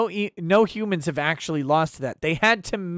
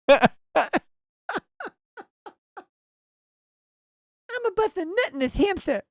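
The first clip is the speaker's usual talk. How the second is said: high, lisping boxer impression; Mike Tyson; 12 semitones higher, faster than usual. Streamer retrying the kangaroo joke, high lisping impression. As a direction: lisp it in a small high voice. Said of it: "Mike Tyson making the first kangaroo says".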